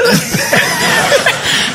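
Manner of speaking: shrill burst of laughter; high laugh. Acted. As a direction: cackle at the plan. Cackle after the plan.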